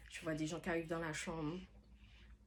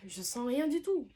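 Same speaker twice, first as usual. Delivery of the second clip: protesting; 7 semitones higher, 6 dB louder than usual. Retold or imitated. Her protest to the midwife.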